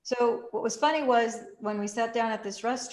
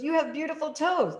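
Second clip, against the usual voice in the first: lightly voiced flirty guy, higher; a hint of a charmed car wash guy; guy's compliment; 8 semitones higher, 3 dB louder than usual. Man complimenting her toes.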